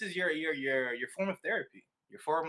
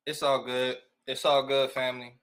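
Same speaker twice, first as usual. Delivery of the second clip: ordinary talk, nothing marked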